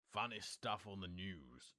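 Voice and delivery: usual speaking voice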